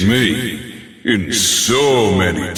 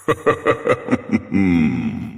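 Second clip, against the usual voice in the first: short haughty boss laugh; mocking laugh. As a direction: laugh down at the challenger. Boss laughing before battle.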